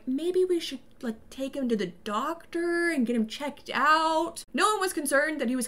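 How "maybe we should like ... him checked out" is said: slow, drawn-out tentative suggestion; mock concern. Parents suggesting a doctor for their son, mock concern. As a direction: put on a hesitant, careful voice. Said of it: "nobody were like".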